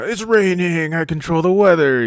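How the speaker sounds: high, loud mock-godlike proclamation; playful giant. Boy pretending to rule an anthill's weather, mock-almighty shout. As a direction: crow it like a kid ruling the world. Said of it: "I'm a giant looking down at this anthill".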